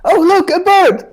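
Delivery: high-pitched, drawn-out fake alarm; mock distraction cry. Joker trying to make the others look away, mock distraction cry.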